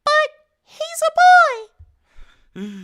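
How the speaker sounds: very high, loud, slow squeal; hammed-up high-pitched whine; squeaky mock voice. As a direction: stretch it out in a silly squeak. Squeaky comment reading.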